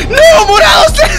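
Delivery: very high, loud, desperate scream; panicked scream. Real, not acted. Player losing the round, screaming the same words in despair. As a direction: scream it as if all is lost.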